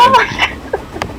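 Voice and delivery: slow, whispered oh my God; whispered disbelief. Real, not acted. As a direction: breathe it out in shock. Reacting to firebombing Dresden.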